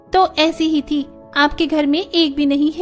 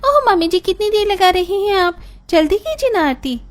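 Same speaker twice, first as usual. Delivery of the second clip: higher, pushy complaint; impatient bride. Acted. Bride hurrying her mother-in-law's ritual, impatient and higher. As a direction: hurry her up, pitch raised.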